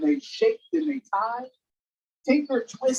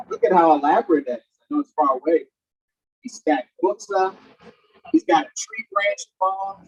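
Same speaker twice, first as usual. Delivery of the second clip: ordinary delivery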